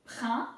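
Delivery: "prin" with a throaty R and nasal vowel; French "prin", leaning on the R; French R emphasis. Shown as a demonstration. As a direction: say "prin" as one isolated French syllable. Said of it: "emphasis on the R".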